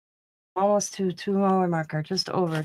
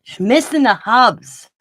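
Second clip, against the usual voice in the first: loud teasing; 2 semitones higher, 11 dB louder, slower than usual. Acted. Teasing about missing husband.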